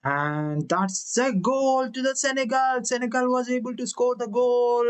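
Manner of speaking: high, excited play-by-play; excited commentary. Acted. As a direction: call the goal with rising excitement. Live goal call in a match.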